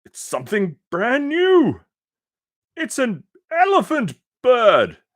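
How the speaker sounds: very high, loud amazed crowd; the crowd marvels at the elephant bird; excited shouting. Acted. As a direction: rave about the new creature. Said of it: "they shouted".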